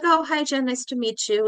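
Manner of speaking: imitated courteous small talk; polite greeting. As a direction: greet a new acquaintance pleasantly, without fuss. Polite greeting before they recognize her.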